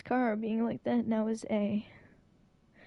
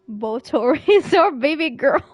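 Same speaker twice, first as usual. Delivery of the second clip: laughing gush; 6 semitones higher, 7 dB louder than usual. Real, not acted. Gushing over favourite character.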